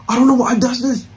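ordinary delivery

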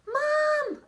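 high, loud call across the dark; yell. Acted. Child yelling to mom after spotting a salamander. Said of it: "I yell".

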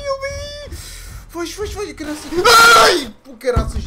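very high, loud yelps; terrified screaming. Real, not acted. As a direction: shriek in real fright. Caught by monster. (gritos de susto)

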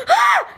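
short, very high startled yelp; retold squeal of surprise. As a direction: imitate a sudden pained shriek. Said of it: "literally was like".

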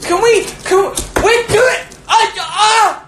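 high-pitched exasperated outburst; shrill-pitched telling-off mid livestream; exasperated scolding. Real, not acted. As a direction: plead for order, voice shooting up high. Scolding the other during livestream.

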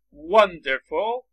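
Wonderful drawn out slowly, stress on WON; slow model word. Shown as a demonstration. Teacher models wonderful for students, very slow. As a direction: spell it out slowly for the class. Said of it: "فول هم بهش اضافه کنید".

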